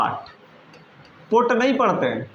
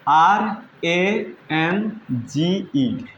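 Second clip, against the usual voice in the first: spelling out letters; much slower than usual. Shown as a demonstration. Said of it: "दूसरा है".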